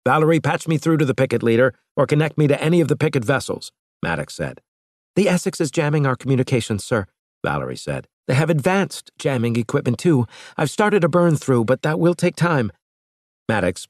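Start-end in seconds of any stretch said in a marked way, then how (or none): 0.0-3.7 fast, higher, urgent command; captain ordering comms fast; urgent captain's order. A narrator's reading. 5.2-12.7 higher, fast officer report; Valerie's voice. A narrator's reading.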